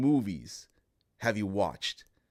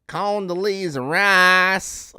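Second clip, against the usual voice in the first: high, slow Southern drawl; Dave Chappelle character; 7 semitones higher, 7 dB louder, slower than usual. Retold or imitated. Quoting a favorite line from the skit, loud drawl. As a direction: draw it out loud with country twang. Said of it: "I love how he says".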